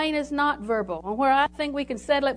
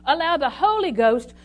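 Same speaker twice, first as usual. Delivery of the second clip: higher, much louder preaching at full force; 5 semitones higher, 8 dB louder than usual. Acted.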